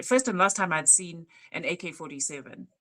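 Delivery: usual speaking voice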